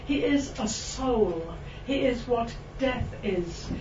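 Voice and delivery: normal talking voice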